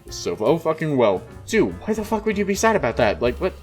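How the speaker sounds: usual speaking voice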